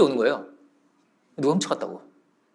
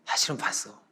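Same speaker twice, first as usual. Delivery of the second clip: quiet elder confiding; kindly master; 7 semitones lower, 11 dB quieter than usual. Retold or imitated. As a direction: gently reveal it in a soft low voice. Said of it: "이렇게 얘기를 하는 거예요".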